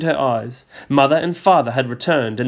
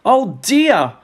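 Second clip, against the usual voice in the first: crying out; 10 semitones higher, 5 dB louder than usual. A narrator's reading. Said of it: "she cried".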